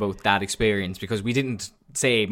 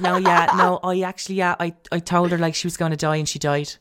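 higher-pitched bad impression of Shauna; 7 semitones higher than usual. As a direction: put on her voice, a little higher. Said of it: "It's a bad impression of Shauna now".

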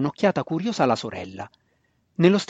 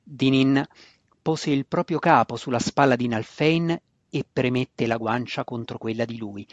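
ordinary delivery, nothing marked

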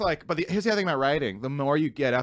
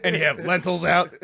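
Usama Siddiqui impression; 4 semitones higher, 4 dB louder, faster than usual. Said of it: "Usama Siddiqui impression".

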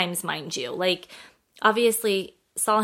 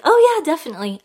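loud, high, imitated enthusiastic yes; retold keen yes; eager agreement; 8 semitones higher, 8 dB louder, faster than usual. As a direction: play back an obliging, upbeat reply.

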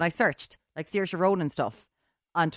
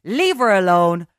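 loud, slow, raised defence of her; emphatic scolding; 4 semitones higher, 10 dB louder, slower than usual. Real, not acted. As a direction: hammer each phrase out at the critics. Scolding lads, defending Saoirse Ronan.

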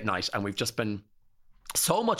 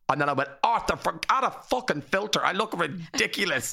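higher, indignant retold rant at husband; mock exasperated; 6 semitones higher than usual. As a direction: throw up your hands at him, pitched high. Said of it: "I afterwards went".

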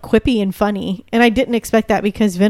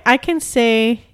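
ordinary delivery

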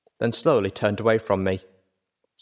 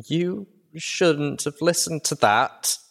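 put-on higher, slow, a young filly's warning; 7 semitones higher, slower than usual. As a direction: say it like a hurt child caught out. Filly catches narrator reading letter.

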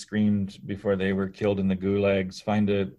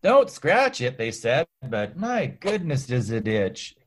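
put-on old-timey sufferer; 5 semitones higher, much slower than usual. Voicing smallpox sufferer.

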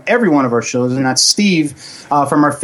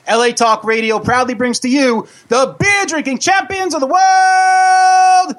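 booming circus announcer intro; ringmaster; 9 semitones higher than usual. Acted. Circus-style show introduction.

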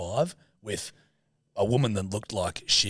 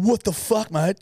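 indignant "what the fuck", pitched high; mock outraged driver, voice jumping up; retold angry outburst; 9 semitones higher than usual. Driver jumps out after hitting a pedestrian, outraged.

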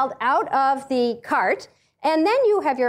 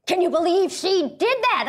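imitating an appalled neighbor, pitched up; higher-voiced mock disbelief; consternation; 6 semitones higher than usual. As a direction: act out a scandalized friend's incredulous question. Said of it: "looking at me with such consternation".

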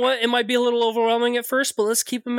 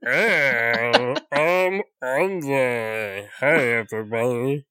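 much deeper, mock gravel-free action-star intro; Vin Diesel impression; 8 semitones lower, slower than usual. A friend greeting the group as Vin Diesel, low put-on voice.

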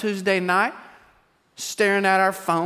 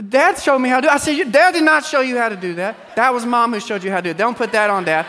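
higher and louder, amused; playful retold banter; 5 semitones higher, 4 dB louder than usual. Dad correcting his son at a friend's house, amused and loud. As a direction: tease it back and forth. Kid and parent banter quoted.

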